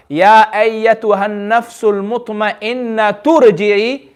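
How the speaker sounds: drawn-out recitation of both verses joined; slow joined recitation. Shown as a demonstration. Teacher recites the joined verses to the class, slowly. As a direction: recite slowly, joining the ayat. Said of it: "even if we join the two ayah together".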